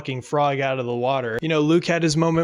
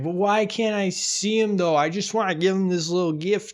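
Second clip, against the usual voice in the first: whiny Mando; 5 semitones higher than usual. Retold or imitated. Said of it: "Mando's like".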